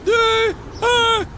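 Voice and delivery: high excited countdown and cry. Pretend. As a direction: count down thrilled before the jump. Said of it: "Voici un extrait".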